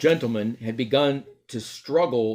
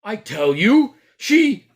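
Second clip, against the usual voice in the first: high, loud insistence; insistent fat man; 9 semitones higher, 9 dB louder, slower than usual. Acted. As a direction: cut off mid-shout.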